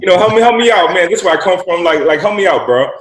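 high, loud begging plea; pleading with the hotel manager, pitched high. Retold or imitated. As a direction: beg him to help you out.

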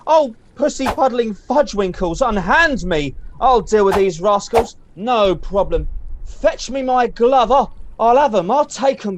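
loud theatrical bluster; hammy stage actor. Acted. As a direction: play the pompous hero.

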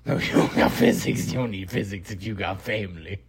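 normal talking voice

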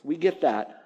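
ordinary delivery

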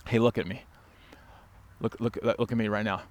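quiet stern summons. Acted. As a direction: draw them close before a rebuke. Pastor answers the complainer intently.

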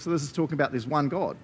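ordinary talk, nothing marked

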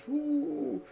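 high happy woo; excited whoop. Real, not acted. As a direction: whoop with delight. Excited about watching TV.

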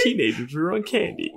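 high, cracking candy excuse; mock mom. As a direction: joins in voicing the mother. Co-host chimes mom's line.

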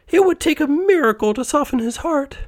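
very high-pitched wifely voice; worried wife. A narrator's reading. Wife tells husband their creditor is heartless, very high voice. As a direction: fret in a voice pitched far up. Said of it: "the lady said".

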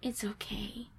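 soft unvoiced comforting whisper. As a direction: whisper it like a counselor. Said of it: "they're like".

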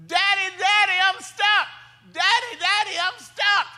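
high-pitched mock yell of a stuck little boy; screaming child. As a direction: imitate a kid calling for daddy from far away. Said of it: "he's screaming from the backyard".

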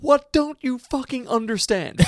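high, slow, hammed-up fury. Song cuts in with star's on-set outburst, mock furious. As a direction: stretch out an exasperated mock rant. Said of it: "from the clip of".

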